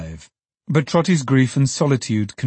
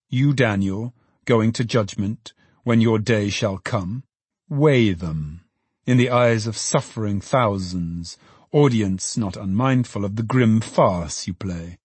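plain reading voice

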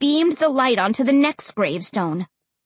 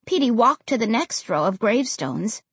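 plain reading voice

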